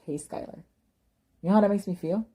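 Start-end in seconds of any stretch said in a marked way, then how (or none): 0.1-0.6 soft whisper. Retold or imitated. Friend's morning call, retold.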